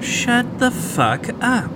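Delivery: exasperated snap. Acted. Friend snaps at Pat.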